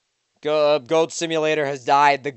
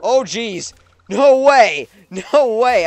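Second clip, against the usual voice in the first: loud, high shout of disbelief; can't believe it, laughing; amazed disbelief; 6 semitones higher, 8 dB louder, slower than usual. Real, not acted. Amazed at landing.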